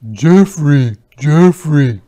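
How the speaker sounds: loud call, dropping on each name. Acted. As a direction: call twice toward the hole. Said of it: "called Merton".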